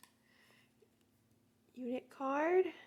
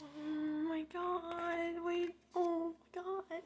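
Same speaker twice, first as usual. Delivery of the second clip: awed squeal; 6 semitones higher, 5 dB quieter, much slower than usual. Real, not acted. Slow awed reaction.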